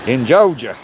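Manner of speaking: mock Southern twang, playfully overdone; bad Southern accent. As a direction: put on a poor Southern accent. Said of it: "my southern accent sucks".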